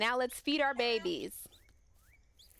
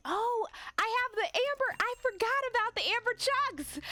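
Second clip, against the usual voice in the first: excited planning; 13 semitones higher than usual. Real, not acted. Finds forgotten amber to sell.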